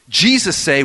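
ordinary talk, nothing marked

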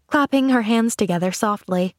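usual speaking voice

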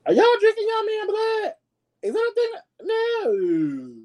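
very high squeaky mock-horrified voice; shocked squeal. Vlogger asks viewers about drinking boyfriend's blood, shrieking high in disbelief.

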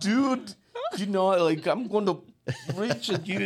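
put-on high, loud laughing retort to his kids; mock warning through laughter. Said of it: "and I'm like".

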